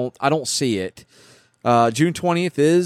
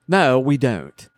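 ordinary delivery

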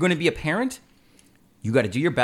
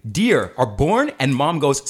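normal talking voice

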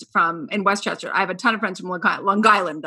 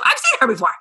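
surprised recognition; 10 semitones higher, much faster than usual. Retold or imitated. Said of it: "I'm like".

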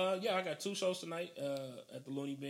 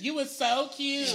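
put-on high, slow girly coo; 12 semitones higher, 10 dB louder, slower than usual. S1 mimicking the girl.

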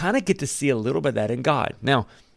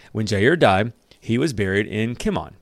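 ordinary talk, nothing marked

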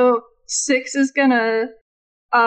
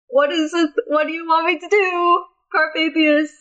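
higher-pitched, halting put-on voice; cat character calling up; 4 semitones higher than usual. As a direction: play a puzzled cat asking for orders. Player voices Six calling Carpathius.

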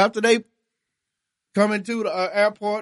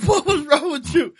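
laughing through quote; 7 semitones higher, 3 dB louder than usual. Retold or imitated.

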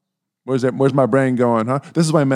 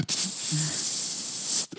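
imitated burst of steam; long steam hiss; 6 semitones higher, 10 dB quieter than usual. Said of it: "it's like".

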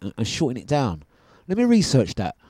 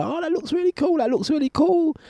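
much higher, squealing delight at the view; mock wonder; 4 dB quieter than usual. Passenger gazing out the plane window, high mock wonder. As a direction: gush like a kid at the window.

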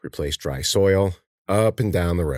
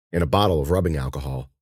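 ordinary delivery, nothing marked

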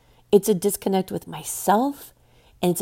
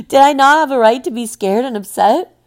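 loud, high-pitched indignation; indignant outburst; 6 semitones higher, 9 dB louder than usual. Real, not acted. Survivor defending her right to be scared, loudly indignant. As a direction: protest it loudly, voice raised well up.

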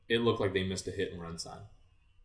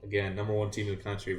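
usual speaking voice

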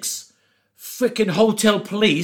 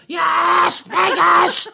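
strained, higher croak ordering food; croaky hungover voice; 12 semitones higher, 4 dB louder, much slower than usual. Retold or imitated. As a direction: force out each word like a zombie. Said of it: "Is something wrong with your voice?".

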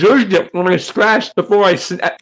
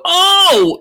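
high, loud, drawn-out mock gasp of shock; shocked reaction; 5 dB louder than usual. Onlooker reacting to a girl's cursing, exaggerated shocked cry. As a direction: re-enact being aghast. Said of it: "I look and go".

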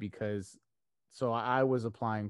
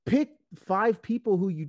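much higher, louder, slow and insistent retelling; emphatic advice; 10 semitones higher, 7 dB louder than usual. Retold or imitated. Friend telling him to pick five people, insistent. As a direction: imitate a buddy spelling it out.

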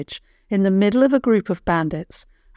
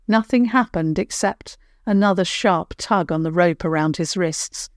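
ordinary delivery

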